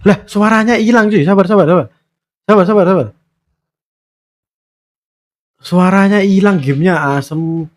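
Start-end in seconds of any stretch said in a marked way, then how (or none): 0.1-6.6 higher-pitched, flustered outburst; alarmed surprise. Real, not acted. Streamer tells viewers the game sound vanished, alarmed. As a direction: blurt it out in sudden dismay.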